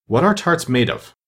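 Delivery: usual speaking voice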